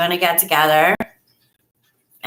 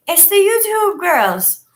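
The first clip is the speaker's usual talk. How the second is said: higher, slow, drawn-out star-spotting; excited recognition; 11 semitones higher, slower than usual. Retold or imitated. People walking by on the beach, slow high recognition. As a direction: say it like a passerby pointing them out. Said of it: "people were walking by being like".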